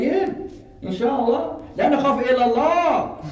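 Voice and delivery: high-pitched, confident retort; bold reply. Retold or imitated. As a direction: retell a fearless comeback. Defiant reply to doubting brother.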